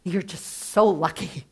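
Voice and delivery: ordinary talk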